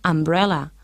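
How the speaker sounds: "umbrella" opened on a plain vowel, without y glide; no Y sound. Shown as a demonstration. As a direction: model "umbrella" with no y at the start. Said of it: "no se pronuncian siempre con este sonido".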